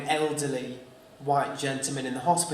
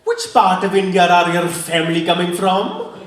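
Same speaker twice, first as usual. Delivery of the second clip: put-on louder Indian accent, imagined question; Indian taxi driver; 8 dB louder than usual. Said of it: "He's thinking".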